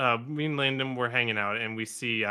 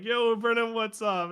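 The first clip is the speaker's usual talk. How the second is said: mock casual greeting; 10 semitones higher than usual. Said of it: "I'm like".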